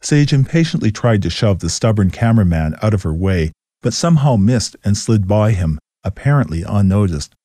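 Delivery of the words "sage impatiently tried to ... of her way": ordinary delivery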